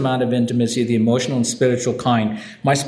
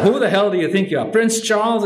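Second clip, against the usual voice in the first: higher, mock-indignant retort; retorting wife; 7 semitones higher than usual. Wife mocking her husband's complaint, in a raised, scornful voice. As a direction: fire back at him with scorn.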